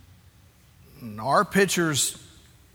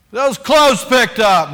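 much louder, higher, slow stern command; harsh order; 7 semitones higher, 13 dB louder, slower than usual. Retold or imitated. Pastor mimicking how he orders his kids, loud. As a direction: bark it like an impatient father.

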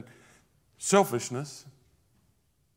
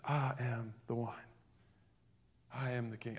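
low and much softer, almost intimate; hushed claim; 5 semitones lower, 14 dB quieter than usual. Acted. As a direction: drop to a near-secret, lower and soft. Same claim, said again softly.

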